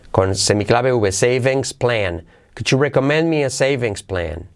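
ordinary talk

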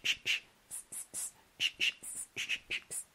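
isolated voiceless hushing sh and hissing s; drilling sh against s. Shown as a demonstration. Teacher drills sh against s, carefully differentiated. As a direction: keep sh and s clearly apart. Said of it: "diferenciarlos bien".

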